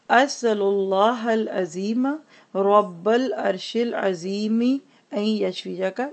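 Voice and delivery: drawn-out Arabic dua; slow model recitation. Shown as a demonstration. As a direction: recite slowly and evenly for learners. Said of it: "आप मेरे साथ दोहरा लीजिए".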